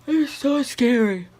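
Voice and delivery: words stretched by a yawn. Real, not acted. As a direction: talk mid-yawn. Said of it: "I can't stop yawning".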